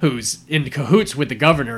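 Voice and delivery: ordinary talk, nothing marked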